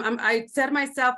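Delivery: ordinary delivery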